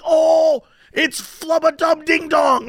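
high, slow, thrilled shout; excited shout. Retold or imitated. Coworker pointing out a streamer, high thrilled shout. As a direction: yell the name like a starstruck fan.

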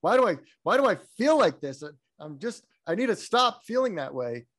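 frustrated self-talk. Retold or imitated. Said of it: "Like, I shouldn't feel mad at my kid".

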